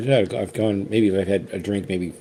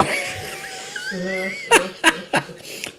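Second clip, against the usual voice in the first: teary laugh; 5 dB quieter than usual. Real, not acted. Laughing while teary over rye.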